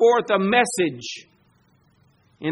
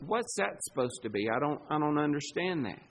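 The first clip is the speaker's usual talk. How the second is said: puzzled bystander; 5 semitones lower, 10 dB quieter, faster than usual. Retold or imitated. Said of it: "where everybody goes".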